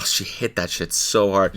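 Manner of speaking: ordinary delivery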